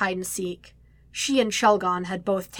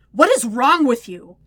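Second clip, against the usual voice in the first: loud, higher, outraged question; horrified anger; 4 semitones higher, 10 dB louder than usual. A narrator's reading. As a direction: demand it loudly, appalled. Horrified, confronting his friend.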